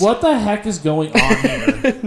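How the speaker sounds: laughing, slow and loud disbelief; outraged disbelief. Real, not acted. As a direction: laugh out what the heck. Reacting to absurd clip.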